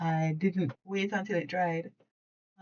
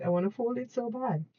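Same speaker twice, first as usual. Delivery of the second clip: higher, eager impatient plea; impatient whine; 6 semitones higher than usual. Real, not acted. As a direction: beg to get on with the folding. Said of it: "I'm feeling very impatient".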